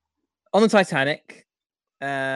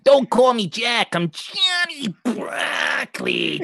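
mock outraged Johnny; 8 semitones higher, slower than usual. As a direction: protest like a wounded gangster, over the top. Playing Johnny Broccoli character, mock indignant.

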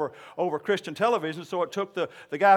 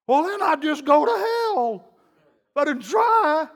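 louder, higher, slow protest; mock exasperated congregant; 9 semitones higher, 7 dB louder, slower than usual. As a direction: whine it like a stubborn believer.

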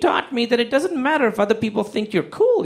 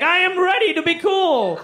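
a much higher, smug new-cool voice; cocky new-cool guy; 11 semitones higher than usual. Retold or imitated. Guy fresh from summer announcing his coolness, in a higher smug voice. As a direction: play the guy who just got handsome. Glow-up guy's new attitude.

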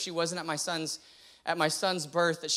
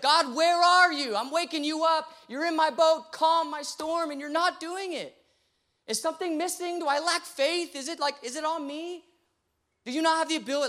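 raised, high-pitched crying out to God; anguished struggling prayer; 11 semitones higher, 5 dB louder than usual. Retold or imitated. Pastor challenging God in a crisis of faith, anguished. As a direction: plead upward with God, demanding an answer. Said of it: "I'm struggling, like, with this idea of".

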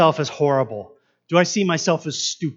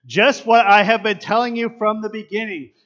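louder, fed-up emphasis on what; exasperated Jesus, louder; 5 semitones higher, 8 dB louder than usual. Shown as a demonstration. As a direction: let the exasperation show, like repeating yourself. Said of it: "Can you hear the exasperation in Jesus' voice here?".

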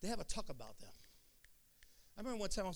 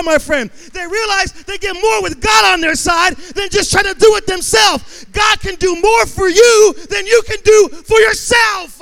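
full-force preaching; 10 semitones higher, 27 dB louder, slower than usual. Real, not acted. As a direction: build from raised storytelling into a high roaring shout. Preacher builds to climax.

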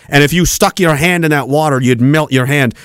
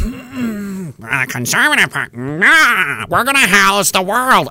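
put-on politician voice, drawn out; mocking caricature of a conservative politician; mocked politician; 3 semitones higher, slower than usual. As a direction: do a silly send-up of a party leader.

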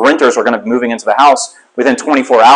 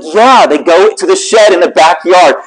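higher, slow, imitating an insistent wife; exasperated confirmation; 4 semitones higher, slower than usual. Wife confirming to husband, slow exasperated insistence. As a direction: spell it out slowly in a raised pitch.

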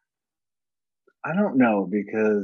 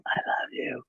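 hushed, voiceless declaration; creepy whisper; 9 dB quieter than usual. Acted. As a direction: whisper it as if to a child. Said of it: "Go to, like, a child".

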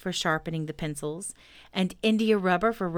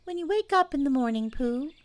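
high little animal voice; small creature asking earnestly; Piglet voice; 8 semitones higher than usual. A narrator's reading. Piglet questions Pooh.

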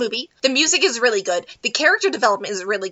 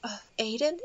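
soft dazed question; 12 dB quieter than usual. Retold or imitated. Waking heroine quoted.

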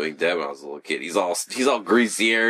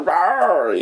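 mock roar; 9 semitones higher, 5 dB louder than usual.

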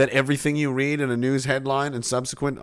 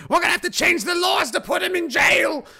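high, loud mocking shout of the critics; mock outraged shout; 3 dB louder than usual. Mocking the critics' outrage in a high shout. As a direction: ham up the outraged officials, shouting.

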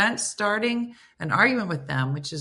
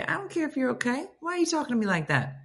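indignant retort; 7 semitones higher, 4 dB quieter, faster than usual. Retold or imitated. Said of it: "I'd be like".

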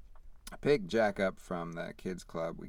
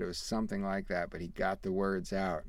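ordinary talk, nothing marked

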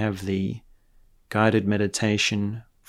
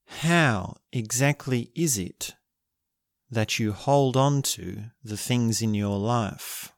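guided meditation; 5 dB quieter than usual. Acted. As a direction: guide them gently into stillness.

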